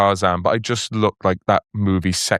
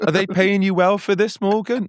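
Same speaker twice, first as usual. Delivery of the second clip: higher, prying mum voice; worried mum; 9 semitones higher than usual. Retold or imitated. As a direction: play the mother quizzing her son. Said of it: "your mom ringing".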